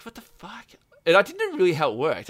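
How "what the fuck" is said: hushed disbelief. Retold or imitated. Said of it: "I was like".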